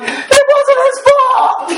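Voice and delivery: high, slow, laughing protest; defending the kid to his mom, high and laughing; laughing plea. Retold or imitated. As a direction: plead through laughter. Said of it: "I'm like".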